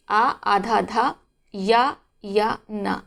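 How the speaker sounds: syllables of अध्ययन said very slowly one by one; slow spelling out. Shown as a demonstration. Teacher spelling out अध्ययन slowly for students. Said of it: "दो बार या होते हैं".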